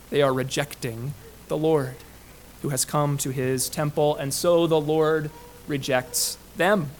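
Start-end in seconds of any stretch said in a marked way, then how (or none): none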